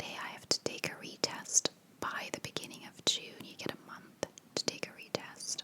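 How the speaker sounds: ordinary talk, nothing marked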